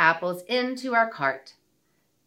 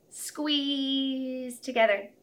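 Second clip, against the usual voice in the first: ordinary delivery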